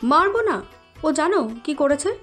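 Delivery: angry daughter defending herself; indignant. Acted. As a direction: argue back at mother heatedly. Ayana angrily defends hitting brother.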